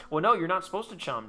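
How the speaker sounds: ordinary talk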